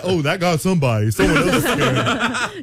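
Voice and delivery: lightly feigned nonchalance through amusement; playing it off. Screamer blames someone else in the theater, mock casual. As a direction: pretend somebody else was the screamer. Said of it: "Or play it".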